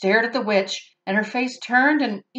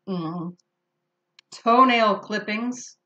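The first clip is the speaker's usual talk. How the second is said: ordinary delivery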